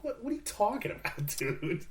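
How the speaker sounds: high-pitched, laughing disbelief; incredulous. Retold or imitated. Viewer reacting to an early sequel announcement, incredulous. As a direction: react in amused mock bafflement.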